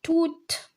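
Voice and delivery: "tout" said toot, high with a released t; sounded final t. Shown as a demonstration. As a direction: read "tout" with the t at the end heard. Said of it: "If we have the E à la fin".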